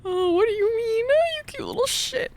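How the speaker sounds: very high, squeaky coo; tiny high voice full of fond dismay; cutesy cooing. Acted. Cooing at something cute.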